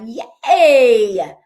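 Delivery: loud delighted yay; cheer. Real, not acted. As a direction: cheer them on, big and bright. Cheering a viewer's first sale.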